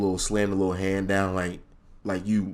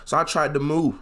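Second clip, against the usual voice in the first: ordinary delivery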